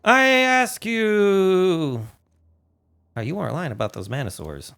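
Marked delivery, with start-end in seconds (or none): 0.1-2.1 very slow, louder, theatrical; drawn-out plea. Pretend. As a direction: stretch it out dramatically.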